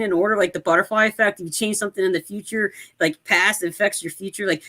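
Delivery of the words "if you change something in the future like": usual speaking voice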